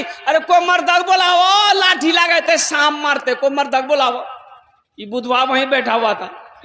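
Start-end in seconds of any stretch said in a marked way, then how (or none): 0.0-4.3 raised panicky call for a man; women shouting for help. Retold or imitated. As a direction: shout for a man to kill the snake. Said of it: "चिल्लाने लगी". (औरतें चिल्लाकर मर्द बुलाती हुई)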